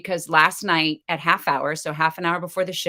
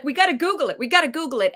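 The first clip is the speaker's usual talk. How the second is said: higher, urgent husband repeating; 8 semitones higher, faster than usual. Retold or imitated. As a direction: push it like a worried dad. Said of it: "my husband is like".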